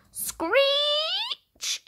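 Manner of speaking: very high held screech; screeching tyres. A narrator's reading. The plane skidding on the runway, screeching. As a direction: imitate the brakes squealing. Wheels bump the ground.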